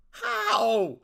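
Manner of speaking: a loud, exasperated cry of how. Real, not acted. Reviewer baffled by Hawkman surviving, exasperated cry. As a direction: throw up your hands at the plot hole.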